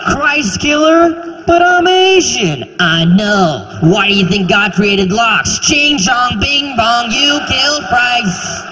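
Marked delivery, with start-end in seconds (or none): 0.1-2.7 high, slow and puzzled; a high, slow protest of innocence. Acted. 5.6-8.6 mock hater.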